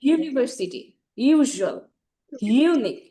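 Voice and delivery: emphatic voiced yoo starting "ইউনিভার্সিটি", "ইউজুয়াল", "ইউনিক"; punched "ইউ" onset in "ইউজুয়াল" and "ইউনিক"; vibrating U. Shown as a demonstration. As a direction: hit the "ইউ" of each word with a buzzing y. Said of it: "এখানে vibrate করবে".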